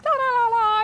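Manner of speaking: high, bouncy mock chatter sound; lightly imitating carefree happy babble. To her viewers, imitating carefree chatter, high and bouncy. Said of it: "usually you're happy and you're like".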